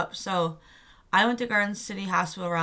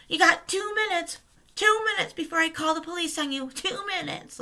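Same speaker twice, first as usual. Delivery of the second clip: high, sing-song mocking of a nag; mocking imitation; 11 semitones higher than usual. Security guard counting down before calling police, mocking. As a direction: mimic the guard in a much higher, taunting voice.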